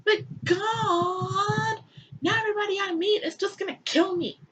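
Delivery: high, pleading put-on whine; hammed-up complaint; whining Cain. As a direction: beg like a scared kid. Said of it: "Cain is like".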